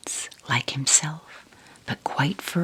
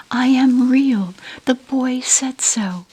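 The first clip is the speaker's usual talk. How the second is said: higher, louder whisper, pleading not to cry; near tears, pleading; 9 semitones higher, 8 dB louder than usual. Acted. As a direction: beg them to believe you, close to tears. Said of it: "he nearly began to cry".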